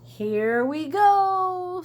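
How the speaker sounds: slow, loud drawn-out call; rally the trucks before the charge; leader's call. Acted. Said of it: "says Elbow Grease".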